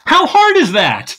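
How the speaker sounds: sarcastic jab at the absurd task. Acted. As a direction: drag it out like it's obviously impossible. Sarcastic quip about masterpiece.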